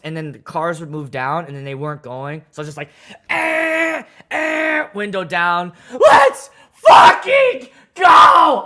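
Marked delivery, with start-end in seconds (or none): 3.3-4.9 high, annoyed "Eh, eh"; impatient grunts. Retold or imitated. Honking at stalled cars. 5.9-8.6 high, much louder, dragged-out yell; furious shout. Retold or imitated. Yelling out car window.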